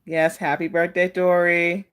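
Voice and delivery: normal talking voice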